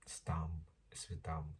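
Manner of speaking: soft breathy self-dig; whispered aside. Real, not acted. Admitting to viewers it is dumb, in a whisper. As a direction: whisper it to yourself, a bit embarrassed.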